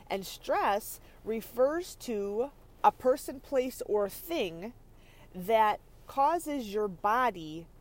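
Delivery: each word laid out slowly and deliberately; slow, weighty teaching delivery; slow emphatic definition. Shown as a demonstration. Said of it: "and by stress, I don't mean".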